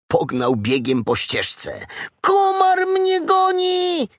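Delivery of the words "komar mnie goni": very high slow shout of panic, stressing komar; piglet shouting. A narrator's reading. Piglet fleeing down the path from the mosquito, high shout. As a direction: cry it out while running away. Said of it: "krzyczał".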